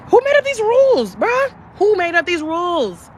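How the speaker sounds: high, loud disbelief; squeaky incredulous demand; exasperated outcry. Real, not acted. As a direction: throw up your hands at the rules. Outraged rant about diet rules.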